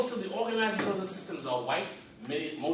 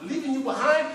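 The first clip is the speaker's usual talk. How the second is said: ordinary talk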